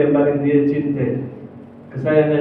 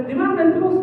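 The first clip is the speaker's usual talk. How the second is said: higher, anxious question; urgent searching; 11 semitones higher, faster than usual. Acted. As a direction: call out looking for him. Umar searching for Abu Bakar.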